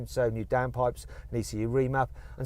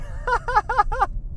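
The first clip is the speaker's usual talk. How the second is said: high burst of laughter; real laugh. Real, not acted. Laughs at the broken paddle story.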